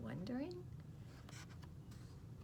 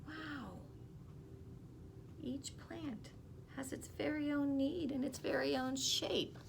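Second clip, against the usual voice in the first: ordinary delivery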